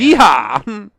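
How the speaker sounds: an attempt at a cowboy holler; half-hearted yeehaw. Shown as a demonstration. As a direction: whoop it out, not quite all-out. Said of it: "you better than that".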